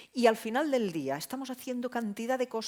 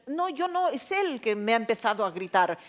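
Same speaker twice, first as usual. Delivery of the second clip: indignant raised-voice retort; acted shouting; 3 semitones higher, 5 dB louder than usual. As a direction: protest loudly that the other started it. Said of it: "¿Pero por qué gritas?".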